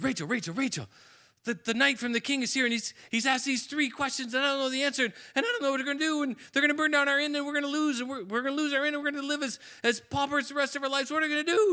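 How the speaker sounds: high, loud, rushing panic; frantic innkeeper babbling to his daughter; panicked father. Acted. Innkeeper panicking to daughter Rachel about losing the inn, frantic.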